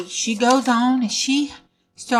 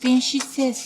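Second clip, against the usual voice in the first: ordinary delivery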